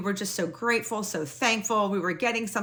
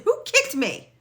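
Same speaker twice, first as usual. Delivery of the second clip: much higher, accusing question; indignant demand. Retold or imitated. Asking her husband who kicked her, high and indignant. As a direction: demand an answer, wronged. Arguing with husband after fall.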